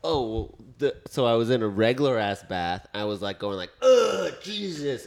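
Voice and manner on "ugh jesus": high, exasperated groan sinking into a grumble; acted-out grumble, starting high; groaning complaint. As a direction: let out a pained, exasperated moan. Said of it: "heard me complaining".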